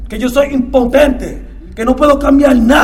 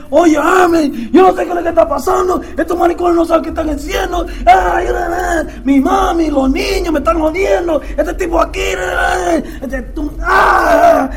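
retold angry ranting; 6 semitones higher than usual. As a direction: rant and rail like a man at the end of his rope. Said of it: "Yo voy donde él".

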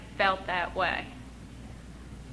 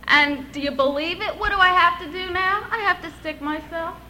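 tearful disbelief; 8 semitones higher than usual. Real, not acted. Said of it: "I started to cry".

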